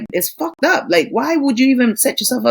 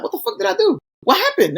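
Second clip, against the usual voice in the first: much higher, bewildered mock husband; hammed-up confused husband; 12 semitones higher, faster than usual. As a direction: squeak it like a clueless man just woken. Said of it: "He'd be like".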